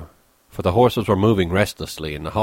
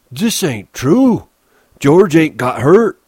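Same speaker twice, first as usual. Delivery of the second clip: put-on high, slow childlike wail of protest; distressed Lennie; 9 semitones higher, 8 dB louder, slower than usual. Lennie denies that George is hurt, distressed. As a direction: cry it out high, loud and drawn out.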